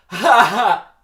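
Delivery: very loud, high fake laugh; unconvincing laugh. Shown as a demonstration. Vanessa reacts to Barry's joke, unconvincing laugh. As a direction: force out a phony ha-ha-ha. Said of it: "I'll do the unconvincing laugh".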